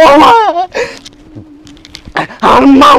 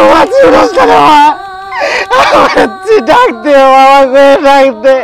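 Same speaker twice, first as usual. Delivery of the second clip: wailing cry. Acted. Son wailing over his mother's body, sobbing shout. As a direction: cry out to her, begging her to wake.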